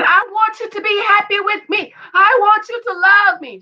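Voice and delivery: high-pitched, insistent retold plea; mock pleading wife. Acting out wife pleading in quarrel.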